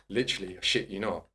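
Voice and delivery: usual speaking voice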